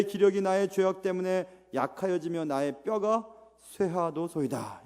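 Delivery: impassioned Bible reading; psalm reading. Acted. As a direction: lay the psalm's grief before God. Reads Psalm 31 aloud.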